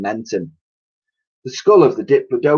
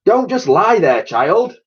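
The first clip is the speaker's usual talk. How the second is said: higher, louder call upward; 9 semitones higher, 4 dB louder, slower than usual. Acted. Professor calls up to Elsie on the tank. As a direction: call up to her impatiently. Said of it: "the professor called up".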